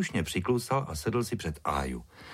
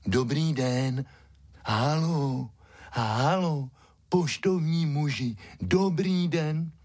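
higher, drawn-out dog voice hailing someone; eagerly calling out; 8 semitones higher, slower than usual. A narrator's reading. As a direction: call after him in long syllables. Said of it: "snaživě volal".

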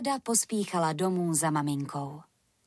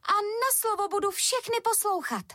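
very high, earnest little bee voice; little bee promising; 13 semitones higher than usual. A narrator's reading. Trapped bee promises the sun to obey, high pleading voice. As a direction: promise anything in a tiny high character voice. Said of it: "včelička proto slibovala hory doly".

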